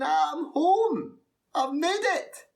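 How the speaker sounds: high-pitched, triumphant cry; elated exclamation. Acted. Storyteller thinking they've made it amid the applause, elated. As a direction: burst out loud with joy.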